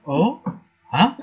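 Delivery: ordinary talk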